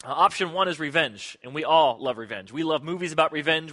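ordinary talk, nothing marked

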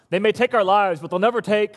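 rising battle cry, held back; restrained Braveheart quote. Retold or imitated. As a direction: quote William Wallace moderately, not yet full force. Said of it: "I'm no William Wallace".

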